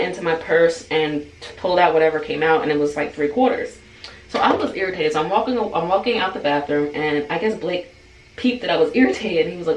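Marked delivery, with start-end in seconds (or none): none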